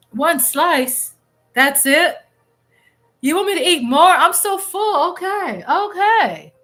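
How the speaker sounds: high, loud, whiny protest; mock protest. Real, not acted. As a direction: complain up high, playfully full. Playful talk back to chat.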